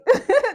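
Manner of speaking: high loud burst of laughter; squealing loud laugh. Real, not acted. Laugh after don't play with me.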